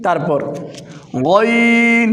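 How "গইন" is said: গইন rasped from the end of the throat; Arabic letter ghain. Shown as a demonstration. Teacher to beginners, throaty ghain demo. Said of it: "হলকের শেষ হইতে".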